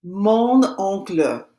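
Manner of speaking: slow "Mon oncle" with the N sounded into "oncle"; linking N. Shown as a demonstration. Teacher shows students the N before a vowel, slow liaison in "Mon oncle". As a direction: carry the N of "Mon" onto the vowel of "oncle", slowly. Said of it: "Notice how I pronounce that N".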